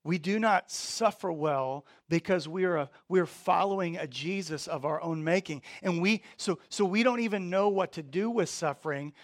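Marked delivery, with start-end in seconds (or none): none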